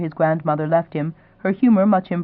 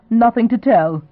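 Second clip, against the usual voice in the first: higher, louder scoffing echo; snorting disbelief; 5 semitones higher, 6 dB louder than usual. A narrator's reading. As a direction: scoff at her answer in disbelief. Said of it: "George Saint John snorted".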